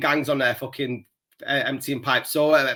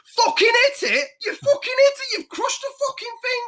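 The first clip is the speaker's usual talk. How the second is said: high squealing panicked shout, hammed up; mock yell. Workmate sees the crushed taxi, high mock shout. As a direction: imitate a workmate losing it, pitched way up. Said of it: "he goes".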